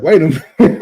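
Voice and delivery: high, loud disbelief with a laugh breaking through; stunned laughing. Retold or imitated. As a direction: laugh in shock at the flavor. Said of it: "I was like".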